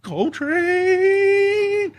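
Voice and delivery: very high, long-held name; mock fanboy squeal, last syllable stretched; starstruck cry. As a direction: draw the name out high in awe. Acts out starstruck reaction on meeting him.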